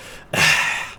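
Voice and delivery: long voiceless huff of breath; exasperated sigh. Shown as a demonstration. Man ignored by the girl, exasperated sigh. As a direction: sigh heavily, fed up at being ignored. Demonstrating the exasperated last line.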